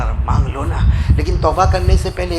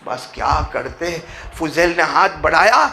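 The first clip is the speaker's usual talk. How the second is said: ordinary talk, nothing marked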